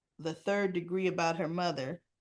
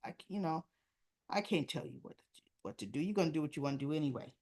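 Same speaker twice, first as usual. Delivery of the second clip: mock dismissive teen; 4 semitones lower, 7 dB quieter, much faster than usual. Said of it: "Jocelyn's just".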